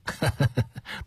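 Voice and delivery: short gloating laugh; smug chuckle. Acted. Schemer savours his secret before revealing it, smug laugh. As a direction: chuckle slyly at his ignorance. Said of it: "用恶毒得意的声调说".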